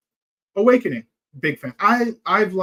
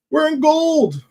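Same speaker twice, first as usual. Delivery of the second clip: excited; 12 semitones higher, 7 dB louder, slower than usual. Real, not acted. Happy about reaching gold rank.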